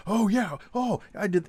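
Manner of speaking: high, loud eager burst; retold burst of eager recognition; mock excited agreement. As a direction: imitate guards excitedly swapping stories. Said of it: "you get so excited".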